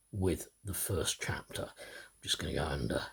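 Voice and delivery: whispered aside to the listeners. Real, not acted. Reader to his audience before the story, whispering. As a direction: breathe it out as a quick whispered aside.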